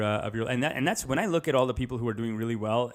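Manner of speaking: ordinary talk, nothing marked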